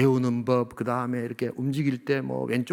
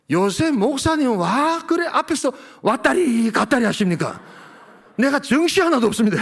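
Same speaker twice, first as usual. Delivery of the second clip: Gyeongsang grandma; 9 semitones higher, 5 dB louder than usual. Retold or imitated. Old Gyeongsang deaconess scolding the pastor, Gyeongsang accent. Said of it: "경상도 그 할머니 집사님".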